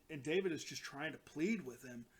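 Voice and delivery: ordinary delivery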